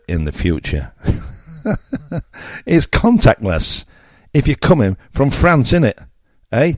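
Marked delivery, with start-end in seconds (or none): 2.6-6.7 sneering high-pitched irony; sarcastic jab. Real, not acted. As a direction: scoff at the idea, drawing it out. Sarcastic jab at French crossings.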